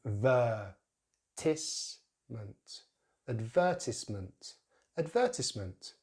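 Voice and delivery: "advertisement" as ud-VER-tis-ment; "advertisement" broken into syllables, then whole; British pronunciation. Shown as a demonstration. Teacher builds the word, "advertisement" stressed on VER. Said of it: "first syllable is a reduced syllable".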